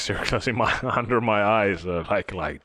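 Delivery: ordinary delivery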